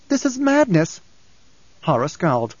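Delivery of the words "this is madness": outraged disbelief. Acted. Ghanima hearing of the meeting, high shocked outburst. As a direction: pitch leaps up high in disbelief.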